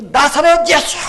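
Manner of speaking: high, loud shout to be heard over a crowd; loud cry. Acted. Blind beggar calling to passing Jesus, loud high shout. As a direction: bellow it out. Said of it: "큰 소리로".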